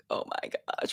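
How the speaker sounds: breathy whispered oh my gosh; whispered aside. Real, not acted. Streamer whispering to herself about the heat. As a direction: let it slip out in a whisper.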